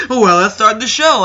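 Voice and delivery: loud, high announcer shout; imitated show opener. Host launching the show, loud mock announcement. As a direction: kick off the show with a big shout. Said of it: "Jon Cena was like".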